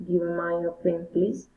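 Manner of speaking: normal talking voice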